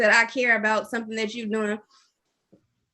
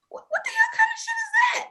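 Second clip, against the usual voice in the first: high, fast, exasperated shout; outraged shout; much faster than usual. Real, not acted. Shouting at a friend about nosy car questions, outraged. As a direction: yell it in disbelief at the nosy question.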